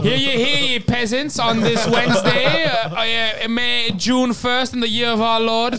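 slow, pompous proclamation; town crier. Pretend. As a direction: announce it like a medieval herald. Mock royal proclamation.

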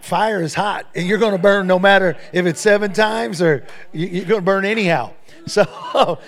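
loud laughing through the words; chuckling hard while making the point. Real, not acted. Preacher laughing at his own joke to the congregation, loud and laughing.

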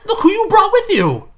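high, loud exasperated yell; angry shout. Acted. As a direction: shout at your friend. Angry confrontation at party.

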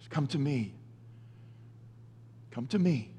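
soft echo. Shown as a demonstration. Preacher repeating the invitation to listeners, hushed. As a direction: let "Come to me" fall to a hushed murmur. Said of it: "Jesus says".

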